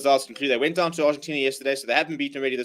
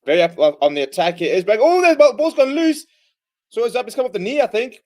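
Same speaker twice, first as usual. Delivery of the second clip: excited commentary; 7 semitones higher, 8 dB louder than usual. Acted. Live rugby commentary, excited.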